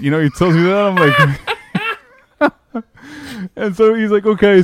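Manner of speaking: can't hold back laughing at the memory; laughing while telling. Real, not acted. Laughing while retelling dad.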